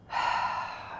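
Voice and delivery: long unvoiced breath out; soft whispery exhale; audible sigh. Shown as a demonstration. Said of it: "breathe in".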